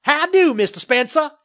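high, loud blustering character voice; blustering developer. A narrator's reading. Developer greeting the landowner in passing, loud bluster. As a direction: boom a brusque greeting, pitched high. Murtaugh greets the father brusquely.

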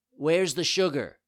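high, loud puppet voice; demanding child. A narrator's reading. Greedy puppet wants sugar.

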